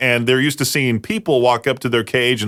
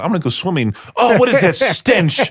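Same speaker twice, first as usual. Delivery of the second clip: mock uppity beachgoer; 5 semitones higher than usual. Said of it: "the uppity uppities of Laguna Beach".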